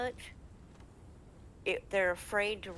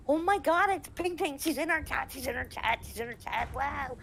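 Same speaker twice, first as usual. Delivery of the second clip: high, giddy mock fan chatter; excited fans; 8 semitones higher than usual. Twitch streamers spot Ping Ting in chat, high excited imitation. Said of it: "They're all excited".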